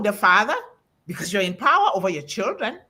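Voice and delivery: ordinary talk, nothing marked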